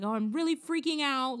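mock panic. Co-host mimicking a caller's panicked voicemail, mock panic. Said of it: "she's, like, panning".

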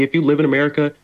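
ordinary delivery

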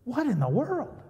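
high, loud shocked gasp of a question. Retold or imitated. Preacher reacting to the neighbor's note, high and loud in shock. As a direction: sound thrown by the note.

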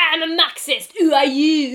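high, loud mock French outburst; too French. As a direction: imitate a ranting French revolutionary. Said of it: "You're being too French".

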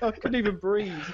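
usual speaking voice

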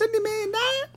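very high squeaky question; squeaky echo. Pretend. Echoing the squeal.

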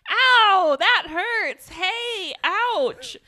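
loud, high mock yelps of pain; hammed-up cries of pain. Retelling the hand in the box, loud mock ouch. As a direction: really ham up the hurt, loud. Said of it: "I put a little more oomph behind it".

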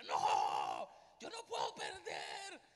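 a cry of no, I can't lose; anguished shout. Retold or imitated. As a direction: yell it like a man who cannot accept defeat. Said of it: "Yo decía".

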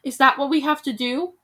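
a loud burst on die; angry challenge. Acted. Bald veteran scorning the call to fight, loud anger. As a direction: throw it back at them. Bald man berating the crowd.

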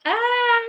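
very high, half-hearted "ah"; high-pitched excited scream, badly faked; feeble mock scream. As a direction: imitate a pathetic attempt at screaming. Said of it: "when he was screaming".

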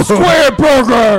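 slow, drawn-out hammed-up announcer shout. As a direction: bellow it like a fast-food ad. Shouting Wendy's menu items game.

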